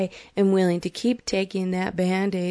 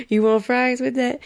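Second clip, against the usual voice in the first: higher, louder cashier voice; put-on fast food cashier; drive-thru worker; 5 semitones higher, 5 dB louder than usual. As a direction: upsell the fries like a drive-thru clerk. Drive-thru worker in joke scene.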